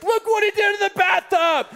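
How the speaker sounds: high, laughing mock shout; shocked wife. Wife holding up the naked son, a high laughing exclamation. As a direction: exclaim it in disbelief through a laugh. Wife shows mess in bathtub.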